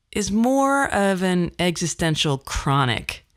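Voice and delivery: ordinary talk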